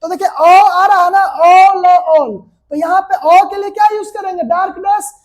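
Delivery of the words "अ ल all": अ drawn out slowly, joined with ल to make all; sounding out. Shown as a demonstration. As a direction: sound out अ and ल, then blend into all. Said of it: "उच्चारण के basis पे". (अ और ल को खींचकर, जोड़कर all बोलें)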